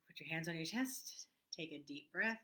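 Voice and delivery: guided breathing. Acted.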